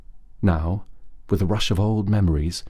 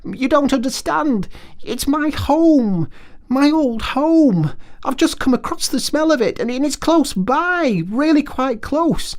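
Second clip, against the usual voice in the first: high, slow, pleading Mole voice; desperate small creature, much higher, drawn out; Mole's painful appeal. A narrator's reading. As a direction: beg him to come back, heart aching. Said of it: "the sharp note of painful appeal in his voice".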